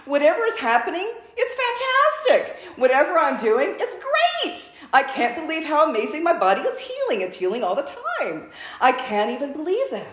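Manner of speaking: high, loud gushing praise for your own body; hammed-up joyful pep talk to yourself; gushing self-talk. Shown as a demonstration. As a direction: cheer your body on, laughing by the end. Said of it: "just start talking to your body".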